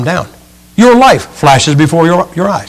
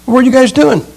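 much higher, curious acted question; high-pitched concern; 8 semitones higher than usual. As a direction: ask with a high lilt.

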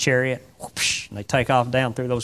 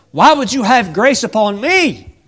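higher, louder wondering; wondering plea; 7 semitones higher, 7 dB louder than usual. Acted. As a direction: ask in disbelief. Ruth asking Boaz.

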